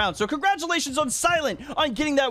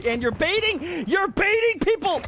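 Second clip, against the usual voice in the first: high, drawn-out indignant protest; outraged gamer protest, stretched out; indignant accusation; 8 semitones higher, slower than usual. Real, not acted. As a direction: call them out, slow and high. Accusing players of baiting.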